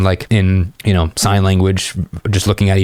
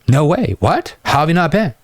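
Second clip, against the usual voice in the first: higher, hammed-up shocked voice; mock disbelief; 8 semitones higher than usual. Guy to girl who never tried Secret Pizza, hammed-up disbelief. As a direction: act stunned she's never been.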